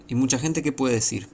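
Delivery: ordinary talk, nothing marked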